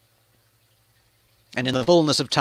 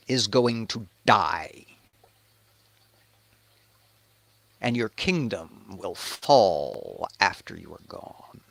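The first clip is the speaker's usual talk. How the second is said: slow, hushed doom sinking lower; grave prophecy; 4 semitones lower, 5 dB quieter, slower than usual. Acted. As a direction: pronounce the curse slowly and softly. Prophet pronounces doom on David.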